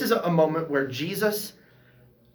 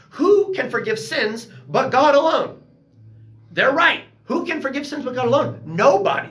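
higher, louder, insistent; excited emphatic; 9 semitones higher, 5 dB louder than usual. Real, not acted. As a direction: drive the point home with fervor.

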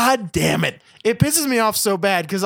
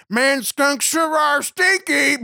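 higher, slow, hammed-up corny dad voice; corny dad joke; 6 semitones higher, slower than usual. Mocking sitcom dads to his co-host, a slow hammed-up corny line. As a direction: drag out the obvious joke like a proud dad. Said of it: "This is like".

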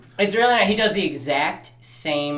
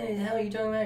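quick, higher, softer retort; mock bafflement; 5 semitones higher, 7 dB quieter, much faster than usual. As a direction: act out a baffled wife in a rush. Voices wife's baffled reply.

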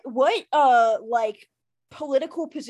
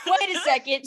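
ordinary talk

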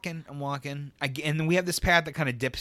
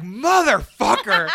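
drawn-out, louder mock curse through laughter; hammed-up outburst of swearing; cursing after a fall; 5 semitones higher, 6 dB louder, much slower than usual. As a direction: stretch the swear out slowly, half laughing. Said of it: "I immediately start cussing".

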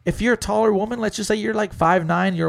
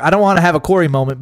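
emphatic; 3 semitones higher, 8 dB louder, much faster than usual. Real, not acted. Fast loud emphatic restart.

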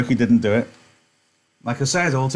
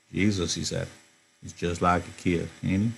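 low, softer calm voice; lower, softer Slim, musing; quiet Slim; 5 semitones lower, 8 dB quieter than usual. Acted. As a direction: say it quietly, almost to yourself.

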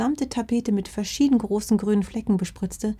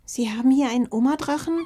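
whimpering; 6 semitones higher, faster than usual. Acted. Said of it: "winselte eins der Fuchskinder".

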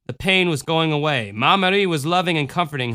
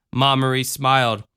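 usual speaking voice

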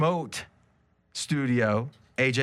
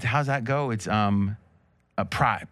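usual speaking voice

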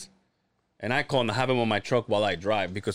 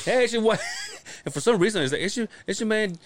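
higher, hyped promo voice of Dre; imitating Dre; 7 semitones higher than usual. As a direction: hype it like a radio host. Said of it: "it sounds just like Dre".

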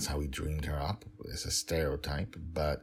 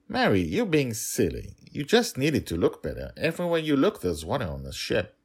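higher and louder, scoffing scold; scoffing father; 8 semitones higher, 7 dB louder than usual. Acted. Dragon Father telling the nanny she is being silly, scoffing. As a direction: make her feel foolish.

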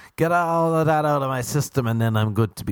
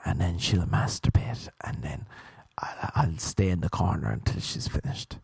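hushed whisper; 5 semitones lower, 6 dB quieter than usual. Retold or imitated. Telling the joke of a girl rejecting him, whispered. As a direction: drop to a whisper for the punchline.

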